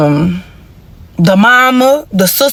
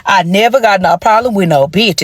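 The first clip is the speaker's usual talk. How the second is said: ordinary talk, nothing marked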